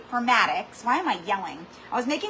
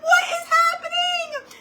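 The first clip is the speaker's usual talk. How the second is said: high, slow cry of disbelief; shouting at the chaos in the kitchen; disbelieving shout; slower than usual. Retold or imitated. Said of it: "I'm like".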